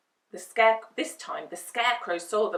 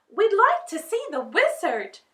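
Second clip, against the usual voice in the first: put-on young girl voice; 13 semitones higher, 9 dB louder than usual. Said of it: "Dorothy told the soldier on guard".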